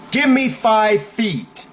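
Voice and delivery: high, slow, forceful order; demonstrated firm command; commanding warning. Shown as a demonstration. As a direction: order someone to back off, each word drawn out. Demonstrates prison saying against bullies.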